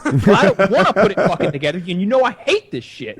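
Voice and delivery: husband snapping back at his wife over the shelves; getting mad. Retold or imitated. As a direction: snap back, half laughing, half fed up. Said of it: "And I'm just like".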